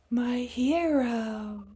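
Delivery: high, very slow swooning sigh; swooning damsel. Acted. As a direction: melt with adoration. Heroine swoons at rescuer.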